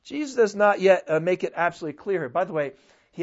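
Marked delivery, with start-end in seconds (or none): none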